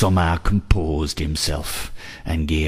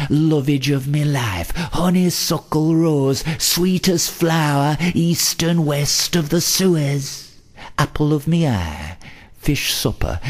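climbing higher and higher with each endearment, then easing down; romantic rapture; 8 semitones higher than usual. A narrator's reading. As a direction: swoon upward into ever higher praise. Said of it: "Uttering words of romantic rapture".

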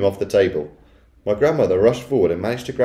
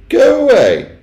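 very high, loud dismissal; affronted, high-pitched order; dismissive Missus Jenkins; 11 dB louder, slower than usual. A narrator's reading. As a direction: shoo her off in a high, haughty voice. Wife dismissing grandmother.

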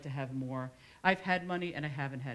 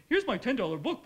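raised, loud hawker's patter; mock sales pitch; 8 semitones higher, 6 dB louder than usual. Author pretends to promote her book, mock sales pitch. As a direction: hawk it in a put-on pitch, half joking.

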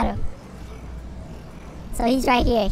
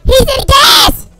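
higher, louder panicked shouts; frantic screaming; 12 semitones higher, 11 dB louder, slower than usual. Real, not acted. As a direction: scream as the fight turns. Frantic final fight.

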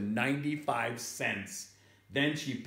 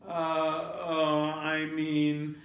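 flustered father; 5 semitones higher, 3 dB louder, much slower than usual. Acted. Father caught out, stammering.

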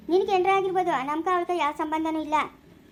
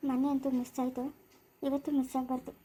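much softer, muttered to himself; quiet aside; 3 semitones lower, 7 dB quieter than usual. Acted. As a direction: plot under your breath. (ತನಗೆ ತಾನೇ ಮೆಲ್ಲಗೆ ಹೇಳುವ ಮಾತು)

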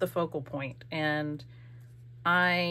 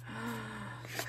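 delighted gasp; 3 semitones higher, 13 dB quieter than usual. Real, not acted.

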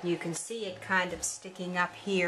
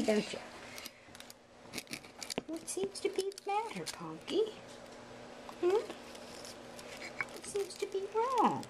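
cooing pet talk; 10 semitones higher, 3 dB quieter than usual. Real, not acted. Owner coos to the wound-up pet, high baby talk.

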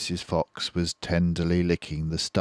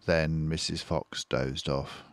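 ordinary delivery, nothing marked

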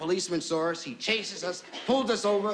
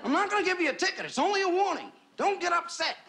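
much higher-pitched, comic placating voice; pleading officer; 11 semitones higher than usual. Acted. Officer tells Lulu it's only a warning, high-pitched pleading. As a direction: pitch it high, trying to calm her down.

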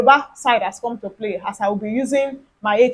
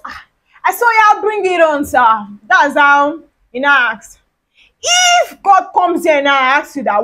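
ordinary delivery

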